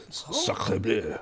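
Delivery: slow guttural mock French; French accent. Shown as a demonstration. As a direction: drag out sacre bleu in a mock French accent. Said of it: "easy to do a French accent".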